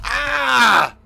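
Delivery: loud sudden yell; startled shout. Real, not acted. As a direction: jump at the scare.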